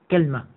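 كلمة said "KAL-ma", falling to close; a after k, no vowel after l in كلمة; kalma form. Shown as a demonstration. Teacher recaps three forms for class, last pronounced kalma. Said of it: "على وزن تمرة".

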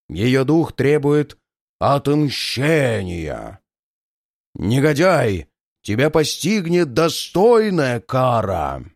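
slow, hollow, menacing spirit; dreadful ghost voice. Acted. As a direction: pronounce doom slowly from beyond the grave. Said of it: "Привидение говорило таким голосом".

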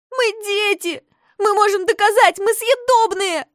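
high, loud hurt shout; indignant child wail; offended wail. A narrator's reading. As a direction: yell after the bear, offended. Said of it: "обиженно завопила Хюльда".

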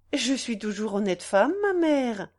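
usual speaking voice

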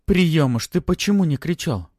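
loud, high, indignant question. A narrator's reading. Ella scolds the little dragon, indignantly. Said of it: "возмущённо спросила Элла".

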